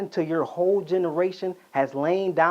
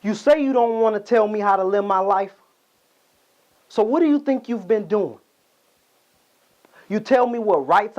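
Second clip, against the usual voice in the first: loud, raised-pitch indignant outburst; angry confrontation; 6 semitones higher, 6 dB louder than usual. Acted. Son telling off his father, indignant and loud. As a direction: throw the accusations back at him, loud and high.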